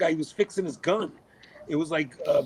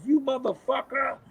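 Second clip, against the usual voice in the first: high, outraged yelp of a shot player; mock fury at being ambushed; retold angry curse; 9 semitones higher than usual. Shot player curses the one who ambushed him from behind. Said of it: "Matty turns around and goes".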